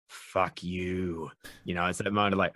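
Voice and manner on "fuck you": quiet glaring retort. Retold or imitated. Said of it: "you just glare at them like".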